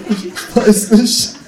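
high, loud, drawn-out wail; mock-tortured slow yell; desperate cry. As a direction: stretch it out as a hammed-up agonised cry. Narrator cries out under interrogation.

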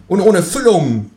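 high, cheeky kangaroo voice. Acted. To the narrator at dinner, high cheeky kangaroo voice. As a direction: pipe up as the smug kangaroo. Känguru voice.

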